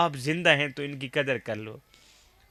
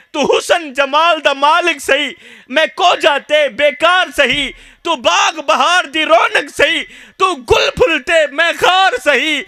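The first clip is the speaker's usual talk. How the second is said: put-on shouted high lament of an old father; anguished outcry; 10 semitones higher, 7 dB louder than usual. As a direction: cry the verse out, pleading and shouting. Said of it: "درد بھرے لہجے میں کہہ رہا ہے".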